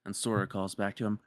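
ordinary talk

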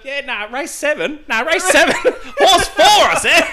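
phone in a bet, laughing; laughing bettor voice. Retold or imitated. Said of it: "on the".

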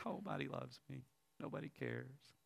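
very soft, hushed despair; imitated crying woman. Preacher echoes woman crying in booth, hushed sorrow. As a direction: voice her hurt in a small, quiet voice. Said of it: "like that woman crying in a booth".

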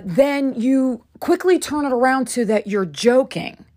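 ordinary talk, nothing marked

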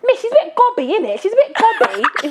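high, teasing student impression; cheeky student voice. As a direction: mimic a cheeky teenager. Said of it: "Even my students are like".

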